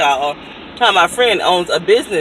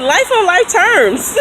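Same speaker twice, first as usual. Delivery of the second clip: slow, weighty, high delivery; slow dramatic; 10 semitones higher, 3 dB louder, slower than usual. Retold or imitated. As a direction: drag it out like a tragic line. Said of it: "I said".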